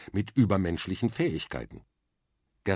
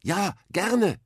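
much higher and louder eager shout; calling out; 13 semitones higher, 6 dB louder than usual. Acted. Arthur shouts to the girl under the bucket, eager call. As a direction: shout it across so she hears. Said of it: "rief er".

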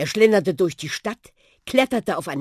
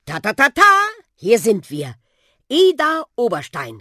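loud, high happy call of arrival; 7 semitones higher, 9 dB louder than usual. A narrator's reading. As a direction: announce the arrival with a big, high shout. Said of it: "rief er".

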